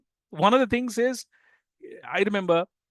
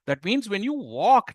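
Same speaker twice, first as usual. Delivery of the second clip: ordinary talk, nothing marked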